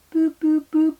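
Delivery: high, loud imitated phone beeps; dial-tone beeps. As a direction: mimic a disconnected line on one high note. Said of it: "You know when it goes boop".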